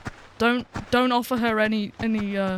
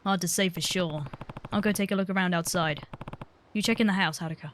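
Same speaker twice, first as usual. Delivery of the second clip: lightly voiced low, quieter character read; composed game hero; 4 semitones lower, 5 dB quieter than usual. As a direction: read the hero's line low and contained.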